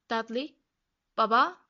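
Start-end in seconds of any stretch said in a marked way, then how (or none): 1.2-1.6 loud sudden call to dad; ansızın seslenme. A narrator's reading. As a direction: call out abruptly, rising at the end. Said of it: "diye seslendi ansızın". (ansızın yüksek sesle seslenme)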